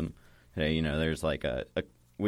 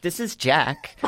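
put-on high, sweet child voice; little girl voice; 10 semitones higher, 7 dB louder, slower than usual. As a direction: introduce yourself like a small girl. Girl introduces invisible friend.

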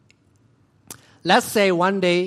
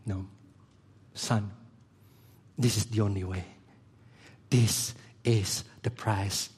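low, soft and slow, a fatherly hush; tender voice of God; 7 semitones lower, 5 dB quieter than usual. Retold or imitated. God the Father gently telling his Son: low, soft, slow.

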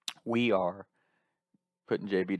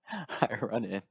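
ordinary delivery

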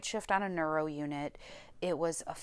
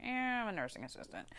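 quick, softer little admission; mock sheepish reply; 3 semitones higher, 8 dB quieter, faster than usual. Applicant admitting to manager she works there, mock sheepish. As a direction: say it small and fast.

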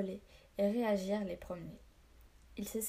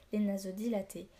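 ordinary delivery, nothing marked

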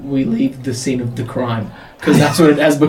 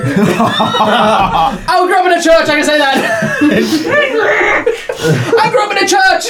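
shouting through laughter; high laughing yell; 11 semitones higher than usual. Real, not acted.

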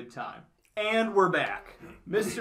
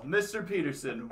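ordinary talk

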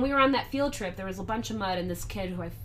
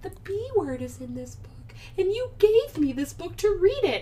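put-on tattling kid; 9 semitones higher than usual. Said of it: "And she's like".

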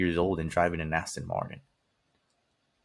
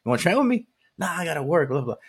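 higher, louder, gushing small talk; bubbly gym chat; 8 semitones higher, 4 dB louder than usual. Retold or imitated. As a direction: gush over a stranger's new hat. Acted upbeat gym chat.

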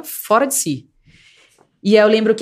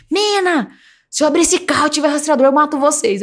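mock threat; 7 semitones higher than usual. Kidnapper threatens them in car.

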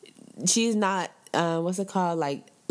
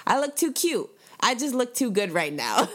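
mock vain gushing; 7 semitones higher than usual. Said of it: "I'm feeling so self-conscious and like".

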